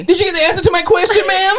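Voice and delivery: high, put-on pestering voice; mocking the lady. Cohost playing the lady in the chair, mock pestering. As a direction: chirp it like a pest.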